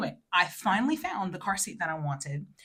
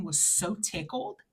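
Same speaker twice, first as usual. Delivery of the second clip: ordinary talk, nothing marked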